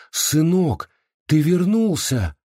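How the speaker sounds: высоко, медленно, с надеждой; чуть не выкрикнуть от радости; почти вскрик. A narrator's reading. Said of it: "Она едва не крикнула". (едва сдержанный возглас)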